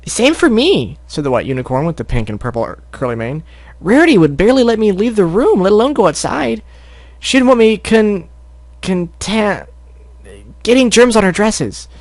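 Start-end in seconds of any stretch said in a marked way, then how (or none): 0.1-1.0 high squeaky filly; Sweetie Belle voice. Pretend. Narrator settling on a squeaky filly voice. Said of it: "vaguely acceptable Sweetie Belle voice". 3.8-11.9 high squeaky filly complaint; Sweetie Belle voice. Acted. Sweetie Belle complaining to friends, high squeaky. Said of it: "vaguely acceptable Sweetie Belle voice".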